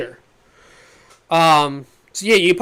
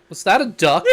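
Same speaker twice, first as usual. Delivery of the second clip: a quoted question with a laugh in it; a bystander's question, laughing as it's said; laughing through the quote. Retold or imitated. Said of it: "the civilian's like".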